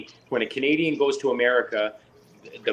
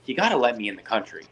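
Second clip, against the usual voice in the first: fed up rant; 4 semitones higher, 5 dB louder than usual. Retold or imitated. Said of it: "I just had it with the girl".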